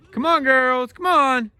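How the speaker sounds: high, loud call across a field. Real, not acted. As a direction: holler the goats in. Calling goats.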